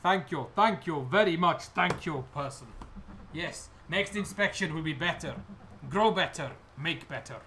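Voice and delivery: put-on fawning, accented gratitude; grovelling goblin. Goblin kissing the inspector's boots, grovelling thanks. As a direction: gush thanks in broken, accented English. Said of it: "dragging on your feet, kissing your boots".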